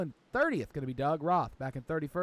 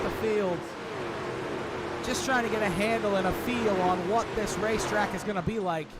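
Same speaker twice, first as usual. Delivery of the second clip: fast, louder, higher race call; live play-by-play; 4 semitones higher, 4 dB louder than usual. Acted. Commentator calling the opening laps to viewers, excited play-by-play. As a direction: call the action as it unfolds.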